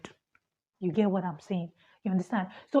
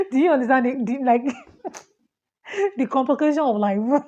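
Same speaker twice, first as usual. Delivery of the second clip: 笑いながら問いかける; laughing; 3 semitones higher, 4 dB louder than usual. Real, not acted. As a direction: laugh through the words, half amused. Laughing at her own struggle.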